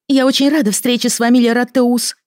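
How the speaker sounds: put-on high, clear greeting from a young girl; ringing girl's voice. A narrator's reading. Lili greeting her mother's old friend, ringing and high. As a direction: ring it out, pitched well up. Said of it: "звонко ответила Лили".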